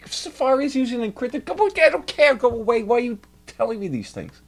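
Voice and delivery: very high, loud whiny protest; mock-exasperated squeal. Real, not acted. Brushing off the browser warning, a high whiny protest. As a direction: shoo the popup away in a high whine.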